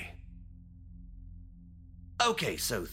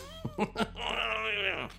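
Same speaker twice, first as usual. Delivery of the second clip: gleeful giggle; 13 semitones higher than usual. Acted. Laughing at his own idea.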